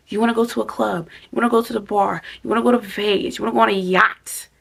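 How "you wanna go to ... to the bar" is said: normal talking voice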